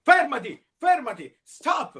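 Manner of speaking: high, loud shout to stop; Red shouting at Yellow to stop. Acted. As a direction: yell at him to stop. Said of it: "urlò Rosso".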